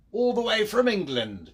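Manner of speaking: high, loud mock English accent; hammed-up attempt at an English accent; terrible English accent. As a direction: put on a bad English accent and belt it out. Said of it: "That was a terrible accent".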